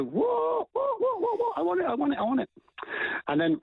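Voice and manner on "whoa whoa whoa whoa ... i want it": very high, excited pleading squeal; eager dog. Retold or imitated. Trainer voicing the dog wanting its toy, eager yelping. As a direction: yelp it like a dog desperate for a toy. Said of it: "your dog's just gonna sit there and go".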